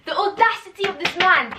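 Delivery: outraged. Real, not acted. Repeats outrage.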